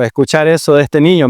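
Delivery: normal talking voice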